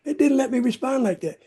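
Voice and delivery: ordinary talk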